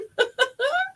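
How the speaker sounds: high-pitched burst of real laughter. Real, not acted. Medium overcome mid-reading, high laughter.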